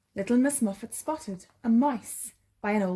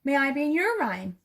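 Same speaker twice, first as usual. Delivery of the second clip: polite; 5 semitones higher than usual. Acted. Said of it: "asked little Miss Muffet politely".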